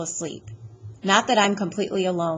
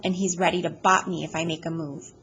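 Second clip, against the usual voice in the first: ordinary delivery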